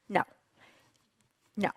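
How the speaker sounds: ordinary talk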